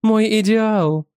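high, slow, dreamy rapture; drawn-out delighted cry; ecstatic. A narrator's reading. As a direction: swoon over the ideal lover. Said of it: "восхищалась жена".

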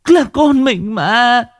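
high, slow, leaning on Là; desperate plea. Acted. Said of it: "cô ta thều thào".

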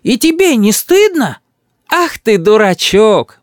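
high, drawn-out scolding; shocked reproach. A narrator's reading. Grandmother reproaches her rude grandson, shocked. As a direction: chide him slowly, in dismay. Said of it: "ахает бабушка".

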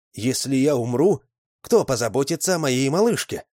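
loud, high worried wail; fretful plea. A narrator's reading. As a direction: fret aloud for the baby. Father frets for baby.

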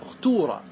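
أختورة said "ukhtuura", u then long uu; damma form. Shown as a demonstration. Teacher contrasting vowel forms, أختورة with damma, "ukhtuura". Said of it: "هذه بالضم".

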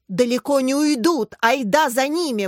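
rally the whole gang; rallying cry. A narrator's reading. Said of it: "бросил клич Дима". (громкий боевой клич)

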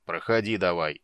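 loud gruff brute; feral wasteland raider. Acted. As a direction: grumble it like a rough guard. Draconid commander growls dismissal. (грубо, по-бандитски)

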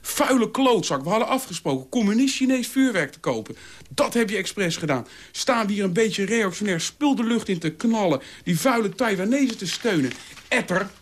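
high-pitched outraged tirade; furious, near exploding. A narrator's reading. Dolf accusing his housemate of betrayal, furious. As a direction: spit accusations, about to blow up. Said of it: "Dolf dreigde bijna zelf te ontploffen".

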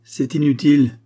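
vif, plus aigu et plus fort; coupe-le d'un ton vif; vivement. A narrator's reading. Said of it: "dit vivement Balthasar". (Balthasar répond vivement au défi)

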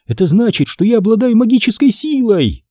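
put-on a girl's voice lifting high with excitement; brightened girl. A narrator's reading. Emily thrilled she has magic, bright high girlish voice. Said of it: "Лицо Эмили немного просветлело".

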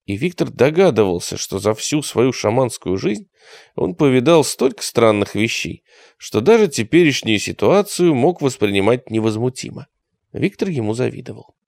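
plain reading voice

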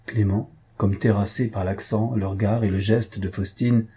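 plain reading voice

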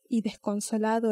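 ordinary delivery, nothing marked

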